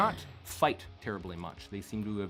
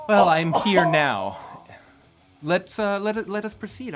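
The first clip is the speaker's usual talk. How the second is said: imperious princess; 10 semitones higher, 13 dB louder than usual. Acted. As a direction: command the room grandly.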